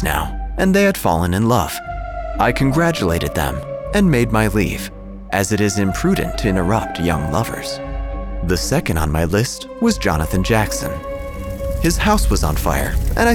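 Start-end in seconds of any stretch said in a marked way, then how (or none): none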